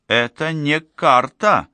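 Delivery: high, slow contradiction with a mild frown; хмурое возражение. A narrator's reading. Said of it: "нахмурившись, ответила Буря". (недовольно, высоким голосом)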